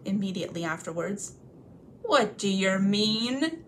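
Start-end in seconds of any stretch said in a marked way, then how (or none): 2.0-3.6 very slow, rough troll question; suspicious troll. Acted. Bert holding Bilbo up by the hair, slow and suspicious. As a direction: drag it out suspiciously.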